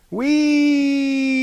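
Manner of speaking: high, loud cart squeal; a delighted squeal as it races; gleeful whee. A narrator's reading. The cart squeals while racing down the street. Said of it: "said the cart as it screamed".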